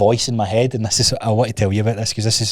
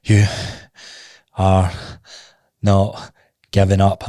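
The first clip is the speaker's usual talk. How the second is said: one word per step, long pauses; slow, deliberate word-by-word resolve; step-by-step self-urging; slower than usual. Retold or imitated. Runner urging himself on with every step, slow and deliberate. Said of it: "every step I'm going".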